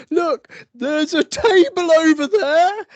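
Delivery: very high, loud mock drunk shout; drunken shouting. Drunk at a party pointing, shouting loudly. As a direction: bellow it like a tipsy show-off. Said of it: "I'm shouting at the top of my voice".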